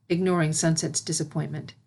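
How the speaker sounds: ordinary delivery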